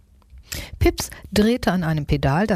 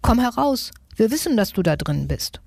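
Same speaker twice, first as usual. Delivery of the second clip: demanding call; 6 semitones higher than usual. A narrator's reading. Mice call to the thief in the shed, demanding. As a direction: order the culprit out, pitch lifted.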